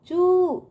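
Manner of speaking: चू held out with a long oo; long, stretched चू. Shown as a demonstration. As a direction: draw out the vowel of चू. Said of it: "इस तरह से पढ़ेंगे". (चू को दूर तक खींचकर)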